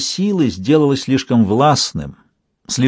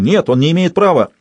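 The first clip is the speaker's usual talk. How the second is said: shouting man; 5 semitones higher than usual. Acted. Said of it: "мужской голос крикнул".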